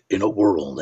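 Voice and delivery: very deep film-trailer narrator; deep, dramatic trailer intro; movie trailer voice. Shown as a demonstration. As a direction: drop low like a trailer announcer. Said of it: "that movie trailer voice".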